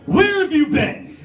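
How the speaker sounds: high, slow scolding demand; stern parent. Retold or imitated. Comedian playing parent to drunk mom, mock scolding. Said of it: "I was like".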